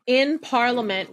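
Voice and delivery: ordinary delivery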